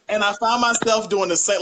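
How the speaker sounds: ordinary talk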